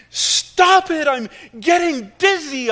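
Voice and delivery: high, loud, slow scream. Acted. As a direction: scream it, spinning and dizzy. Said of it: "I screamed".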